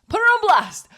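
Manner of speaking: high loud call-out; playful shout. Real, not acted. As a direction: shout it out like a headline. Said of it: "so I'm putting her on blast".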